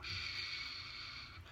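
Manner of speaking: long, soft shh sound; hushing silence. Retold or imitated. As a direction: imitate sudden silence with a fading shh. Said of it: "everything becomes totally silent".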